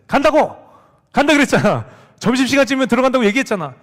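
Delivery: loud, high angry yelling at his son; angry shout. Retold or imitated. Father yelling at his son to go to school, angry shout. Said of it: "화를 냈어요".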